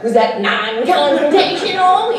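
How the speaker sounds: high, loud, slow sarcastic question; mock innocence after the shooting; deadpan sarcasm. Acted quote of his killer character.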